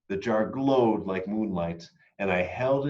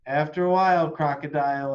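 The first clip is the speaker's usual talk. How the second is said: higher, louder playful reply; child calling back; 5 semitones higher, 4 dB louder than usual. A narrator's reading. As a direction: toss it back cheerfully. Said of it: "I called back".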